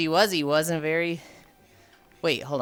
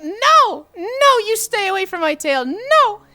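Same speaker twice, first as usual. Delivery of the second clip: much higher and louder, squeaky, pleading as Coco; 14 semitones higher, 6 dB louder, slower than usual. Retold or imitated. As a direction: squeak out a panicked refusal like a small girl. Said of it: "Did she get the high squeaky voice?".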